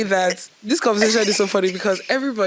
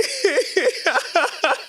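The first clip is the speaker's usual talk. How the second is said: high-pitched helpless laughter; real giggling fit; 9 semitones higher than usual. Real, not acted. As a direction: laugh before you can start the story. Laughs before telling funny story.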